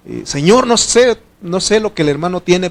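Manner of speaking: usual speaking voice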